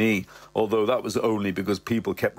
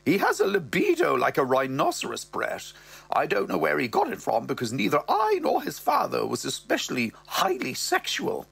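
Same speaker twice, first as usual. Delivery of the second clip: higher mother voice, slowing at the end; mother's voice; 6 semitones higher than usual. Acted. Mother telling Brett about her son, higher voice. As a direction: lift into the mother's voice. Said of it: "she goes".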